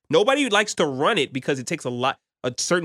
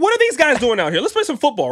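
high, louder bewildered outburst; confused outraged player; 8 semitones higher, 5 dB louder than usual. Retold or imitated. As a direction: play it as a baffled player yelling. Alabama players voiced, confused.

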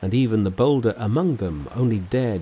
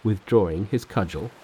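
ordinary delivery, nothing marked

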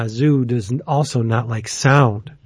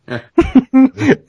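high, loud burst of laughter; 12 semitones higher, 5 dB louder than usual. Real, not acted. Telling friends a racy story, amused laugh. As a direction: break up laughing.

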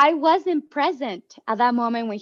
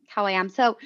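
ordinary delivery

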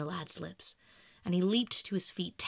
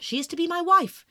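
emphatic declaration; 8 semitones higher, 10 dB louder than usual. Acted. Dorian declares his engagement.

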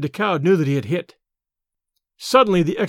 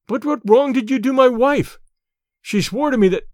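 higher-pitched, urgent questioning; agitated demand; 6 semitones higher than usual. A narrator's reading. Decaud to Tarzan about his wife, agitated. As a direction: press for the answer, pitch raised.

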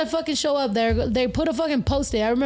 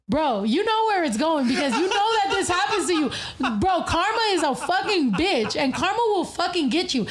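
talking through laughter, pitched up; laughing; 5 semitones higher than usual. Real, not acted. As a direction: laugh while warning about karma. Laughing while telling own story.

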